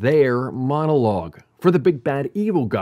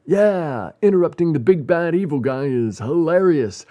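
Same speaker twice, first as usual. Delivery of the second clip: higher, lightly voiced amused player; a hint of a delighted player; gleeful player; 5 semitones higher than usual. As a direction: say it with a touch of cocky glee. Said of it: "summed up as players saying".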